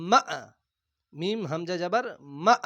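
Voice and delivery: মা sounded with a bouncing jolt on the hamza; qalqalah jolt. Shown as a demonstration. Teacher demonstrating the qalqalah jolt to learners. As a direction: give the hamza a sharp jolt. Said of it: "ধাক্কা কেমন হবে?".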